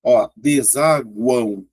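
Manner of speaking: desaguão stretched slowly, U glide heard; slow syllable split. Shown as a demonstration. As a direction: draw out desaguão for the class.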